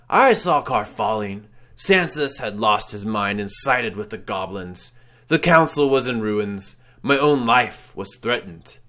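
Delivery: the wraith explains his grand scheme; ghostly lament. Acted. Wraith tells his history.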